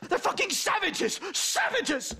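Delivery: high, shrill-free raging cry of savages; furious rant. Acted. Professor raging at the attackers, high and angry. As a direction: spit it out in outrage. Professor ranting after ambush.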